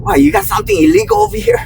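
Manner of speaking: very high, fast taunting voice; mock taunt. Me taunting the couple about something illegal, very high fast. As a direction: needle them, hammed-up.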